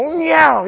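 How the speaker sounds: high swooping engine whine that falls away; motorcycle zooming past. Retold or imitated. Preacher mimics a motorcycle on the interstate, zooming whine. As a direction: imitate a bike flying by. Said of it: "goes flying by on a motorcycle".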